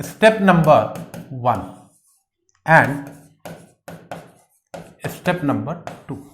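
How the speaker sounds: low, slow, with long gaps; slow dictation. Real, not acted. Teacher writing two step headings, slow low dictation. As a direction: spell out headings slowly as you write.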